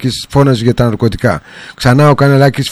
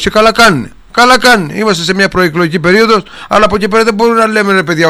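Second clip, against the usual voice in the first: emphatic outburst; 7 semitones higher than usual. Real, not acted. Host backing citizens' mockery.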